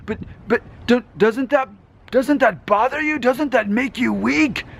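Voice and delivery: high-pitched Eastern European villain voice; weird Eastern European accent. Acted. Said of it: "some kind of weird Eastern European accent".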